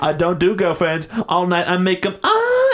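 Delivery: loud high smug boast; smug rapper voice. Retold or imitated. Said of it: "rapper quote".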